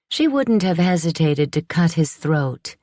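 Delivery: usual speaking voice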